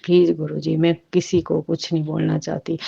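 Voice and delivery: ordinary talk, nothing marked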